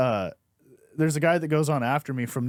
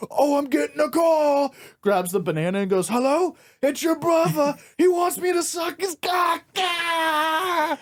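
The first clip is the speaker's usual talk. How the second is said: much higher, shouted silly voice ending in a cry; hammed-up phone bit; 11 semitones higher than usual. Comic pretending a banana is a phone, shrill hammed-up shout. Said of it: "He goes".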